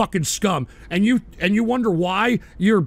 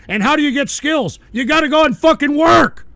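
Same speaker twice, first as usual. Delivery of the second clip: higher, louder furious shout; real angry yell; 6 semitones higher, 6 dB louder than usual. Real, not acted. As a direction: bark it out in outrage. Ranting at a chatter.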